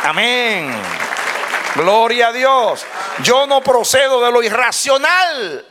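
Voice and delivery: high, ringing declaration of faith; impassioned sermon cry; full-force preaching. Real, not acted. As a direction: proclaim it to the whole congregation.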